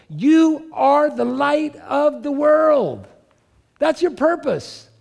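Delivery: soaring, slow proclamation of the verse; preacher's peak moment, high and slow; proclaiming high. Acted. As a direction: lift it up high and stretch every word.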